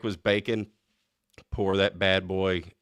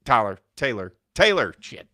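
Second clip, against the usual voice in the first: normal talking voice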